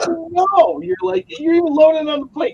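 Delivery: high, strained comic whine; high mock whine. Joking to friends about his wife's cooking, high whine. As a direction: play the helpless glutton.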